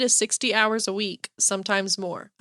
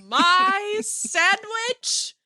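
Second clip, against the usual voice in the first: high, drawn-out mock-indignant squeal; mock outrage; 9 semitones higher, much slower than usual. Friend reacting to the lunch thief, high drawn-out outrage. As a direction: stretch it out, high and offended.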